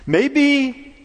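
rising altar-call appeal, pitched high and pressing; building a fervent sermon plea; preaching. Acted. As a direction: press the congregation to surrender.

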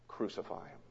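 very soft, low, giving in; defeated surrender. Acted. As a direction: let "Crucify him" fall almost silent, beaten down. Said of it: "he caves in".

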